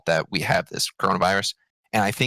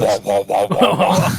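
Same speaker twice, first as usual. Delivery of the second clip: mock crying; 7 dB louder, slower than usual. Mocking friend's laptop defeat.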